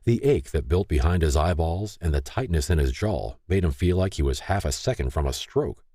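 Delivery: usual speaking voice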